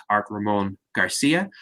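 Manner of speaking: ordinary talk, nothing marked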